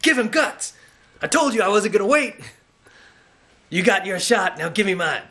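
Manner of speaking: a brash boxer trash-talking; Clubber Lang impression. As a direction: taunt him like a cocky boxer. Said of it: "Clubber Lang ... He's like".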